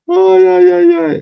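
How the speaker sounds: laughing squeals of yeah at a funny comment; squealing laugh. Real, not acted.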